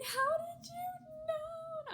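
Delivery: hammed-up, high drawn-out wail; crying wail. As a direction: stretch it into a mock sobbing howl. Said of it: "even harder started crying".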